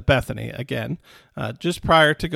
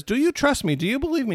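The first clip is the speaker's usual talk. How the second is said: pleading questions pitched much higher; earnest plea; 10 semitones higher, faster than usual. Retold or imitated. Jesus asks Martha to trust him, pleading high. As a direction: beg her to trust you, rising high.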